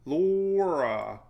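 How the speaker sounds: "Laura" loud and stretched on the first syllable, LOOOR-uh; exaggerated pronunciation. Shown as a demonstration. Joking through the list of storm names, exaggerated pronunciation. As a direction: draw out "Laura" as a mock-careful sounding-out. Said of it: "pronunciation guide".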